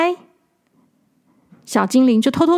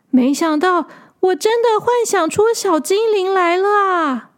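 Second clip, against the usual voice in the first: put-on higher, amazed little girl voice; surprised little girl; 5 semitones higher than usual. A narrator's reading. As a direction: gasp in wonder like a small child. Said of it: "小女孩说".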